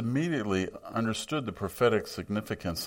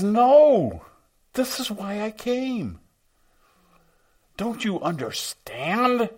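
emphatic rebuke; 13 semitones higher, 6 dB louder, slower than usual. Retold or imitated. Jesus firmly rebukes the disciples.

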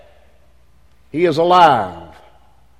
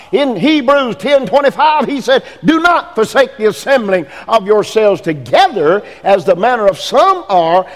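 higher, louder preaching of scripture; 6 semitones higher, 4 dB louder than usual. Acted. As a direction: thunder the verse to the congregation. Preaching scripture at full force.

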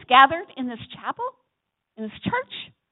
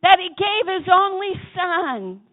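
slow, loud and higher, calling it out; louder; 5 semitones higher, 5 dB louder, slower than usual. Shown as a demonstration. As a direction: lift the verse up for everyone to shout. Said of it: "Yeah, say it louder".

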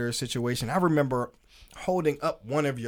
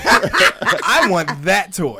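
laughing; 5 semitones higher, 10 dB louder, much slower than usual. Acted. Jokes about being jealous.

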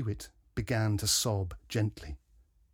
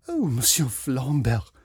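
ordinary delivery

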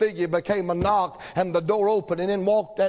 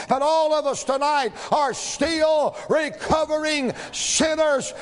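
rising shouted sermon cadence, stretched words; preaching at full force; 7 semitones higher than usual. Acted. Preacher to his congregation, shouting full force. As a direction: drive the point home to the congregation.

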